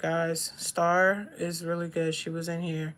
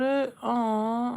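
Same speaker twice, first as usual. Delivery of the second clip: ordinary talk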